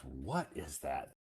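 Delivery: slow, mildly disgusted question; disgusted visitor. Retold or imitated. Visitor to Iowa asking about the stink, mildly disgusted. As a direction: wrinkle your nose at the smell. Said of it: "somebody says".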